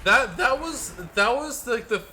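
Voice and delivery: excited. Real, not acted. Streamer amazed by game moment.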